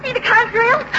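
ordinary talk, nothing marked

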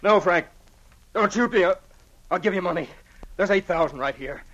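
loud, high, frantic begging; panicked plea to the man with the gun; desperate pleading. Acted. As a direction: beg for your life at gunpoint. Begging gunman for his life.

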